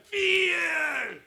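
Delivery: Fear yelled high and loud; shouted word. Shown as a demonstration. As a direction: blast out Fear to drown phones. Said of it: "소리를 지르냐면".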